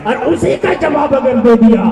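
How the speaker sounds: high-pitched, ringing sermon delivery; urgent, pressing religious oratory; impassioned preaching. Acted. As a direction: preach it with full force to the crowd.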